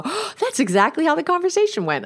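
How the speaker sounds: high, mock-amazed voice; lightly imitating a client's surprised recognition. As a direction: people recognizing their own argument. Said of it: "people go".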